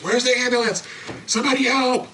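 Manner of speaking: high, desperate plea for help; imitating frantic relatives calling out. Relatives searching for the ambulance, high frantic cry for help.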